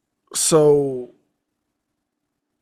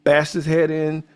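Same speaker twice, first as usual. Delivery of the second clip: building rant; 4 semitones higher, 6 dB louder than usual. Retold or imitated. Detective laying out the crime to Gillespie, building to a loud angry shout. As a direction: build from explaining to yelling at the fool. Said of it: "He's like".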